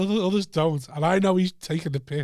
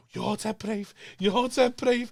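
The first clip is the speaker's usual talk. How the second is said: higher-pitched, blubbery drunken admiration, imitated; mock tearful praise; 7 semitones higher than usual. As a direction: imitate two men tearfully praising each other. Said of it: "had a good cry for two hours".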